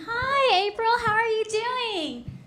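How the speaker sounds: mock high-pitched gushing greeting, loud and drawn out; chirpy PTA mom. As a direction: imitate an over-friendly PTA mom, way up high. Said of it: "Sharon always comes up to me".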